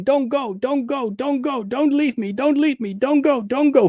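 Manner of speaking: high pleading wail, mock hysterics; mock hysterical pleading. Girl begging her father not to leave, high mock wail.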